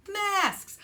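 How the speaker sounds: masks! bursting out high and loud; spring the word like a happy surprise; thrilled teacher announcing the treat; excited reveal. Real, not acted. Big reveal of what kids love.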